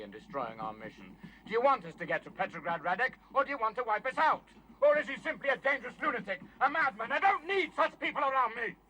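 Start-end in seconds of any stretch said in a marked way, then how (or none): 1.4-8.8 high, loud furious tirade; worked-up rage. Acted. Leader confronting Radek over betraying the mission, furious shouting. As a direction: shout accusations, pitch climbing fast. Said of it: "you shouldn't get so excited".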